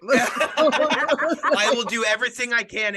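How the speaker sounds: talking through laughter, slow; laughing. Real, not acted. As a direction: promise it while cracking up. Laughing while promising to blur.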